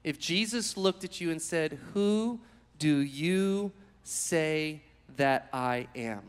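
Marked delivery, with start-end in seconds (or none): 1.9-6.2 very slow, drawn-out, softer question; slow searching question. Acted. Jesus asking his disciples, slow and searching. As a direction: let each word hang, slow.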